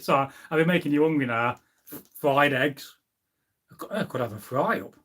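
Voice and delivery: ordinary talk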